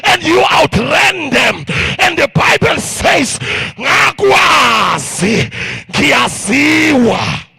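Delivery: loud, rising preacher's call; full-force preaching. Acted. Preacher telling the crowd they're overcomers, full-force sermon. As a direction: preach it out, building fervour.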